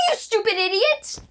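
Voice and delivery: high, sharp mock insult; heated family shouting. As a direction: yell it at your brother. Sibling squabble, name calling.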